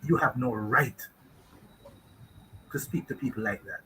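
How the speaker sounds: low and soft, steady; quiet firm rebuke. Retold or imitated. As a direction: set him straight without raising the voice. Retold rebuke to boss.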